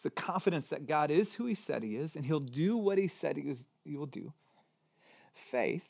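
normal talking voice

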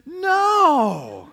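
high, loud, drawn-out refusal; emphatic refusal. Retold or imitated. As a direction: imitate a wife's firm veto, long and loud. Acts wife refusing a pet.

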